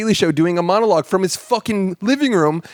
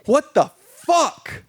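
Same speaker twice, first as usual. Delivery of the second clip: incredulous exasperation; 6 semitones higher than usual. Retold or imitated. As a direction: protest in disbelief, stretching the final words. Acting out his exasperated reaction.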